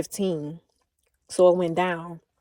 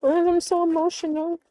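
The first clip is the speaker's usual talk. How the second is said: mock tearful whine; 10 semitones higher than usual.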